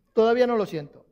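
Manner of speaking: ordinary delivery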